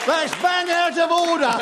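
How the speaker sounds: a hammed-up Cockney accent, drawn out slowly; mock Cockney. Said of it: "That's the way it should be spoken".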